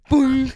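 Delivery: loud comic boom; sound effect. Retold or imitated. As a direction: punch it like a cartoon hit.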